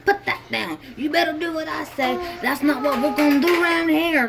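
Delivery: a shrill mocking impression of a bossy mother scolding her kid; bossy drill sergeant. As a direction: bark orders like a drill sergeant mom. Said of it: "bossing him around".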